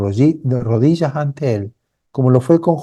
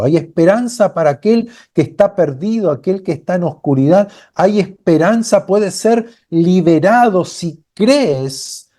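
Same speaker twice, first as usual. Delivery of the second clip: rising up into a fervent preaching climb; 6 semitones higher than usual. Acted. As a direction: lift the congregation higher with each line. Sermon climax rising in fervour.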